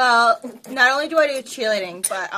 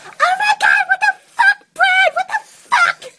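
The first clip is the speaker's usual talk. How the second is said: high-pitched, loud outraged screech; shocked shriek; 6 dB louder than usual. Acted. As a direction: shriek it at a cheating boyfriend. Acted shock at catching boyfriend.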